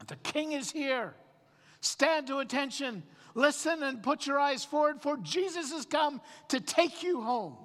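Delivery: high-pitched ceremonial proclamation; Michael as herald. Acted. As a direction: announce the king's arrival like a court herald. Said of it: "Michael will say that".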